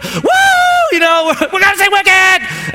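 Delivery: high squealing shout; ecstatic wife shout. Retold or imitated. Pastor acts his wife's thrilled reaction to the tickets, loud high squeal. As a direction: scream with pure joy.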